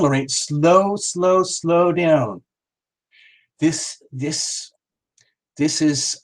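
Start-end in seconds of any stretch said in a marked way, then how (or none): 0.3-2.4 very slow, stretched-out plea; drawn-out slowing down. Real, not acted. Host asking a fast-typing viewer to ease off, drawn-out slow words. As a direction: stretch every "slow" right out.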